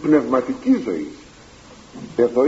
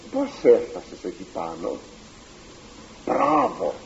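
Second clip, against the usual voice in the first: lower, softer voice of awed onlookers; amazed crowd; 10 semitones lower, 6 dB quieter than usual. Retold or imitated. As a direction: play the world now admiring. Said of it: "χάθηκε να σταυμάζει και λέει". (χαμηλή φωνή θαυμασμού του κόσμου)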